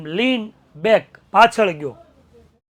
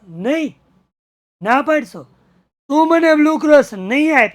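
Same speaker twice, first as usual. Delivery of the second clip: loud, higher, angry shout; 7 semitones higher, 8 dB louder than usual. Retold or imitated. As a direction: bellow it in outrage. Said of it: "રાડ નાખી જોરથી".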